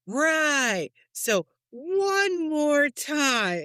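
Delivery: high, very slow prompting; mock teacher prompt. Mother coaxing her son to repeat, high and very slow. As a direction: drag it out like a patient teacher. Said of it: "mother drilling son on the phrase".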